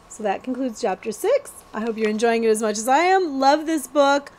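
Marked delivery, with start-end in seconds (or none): none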